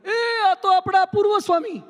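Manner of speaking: high, loud call of recognition across the street; retold shout. Woman in Kerala shouting on spotting the swami, loud and high. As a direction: call out loud, amazed to spot him. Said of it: "અચાનક એક બેને બૂમ પાડી".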